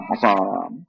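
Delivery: low, drawn-out mock sourness; sour demo. Shown as a demonstration. Preacher shows congregation a sour manner, mock sour. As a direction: drag it out low and slow, playing sour. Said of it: "Try masam".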